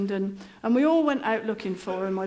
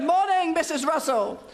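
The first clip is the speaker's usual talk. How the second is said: trainer greeting, redone; 5 semitones higher than usual. Retold or imitated. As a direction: redo the trainer's hello, bigger.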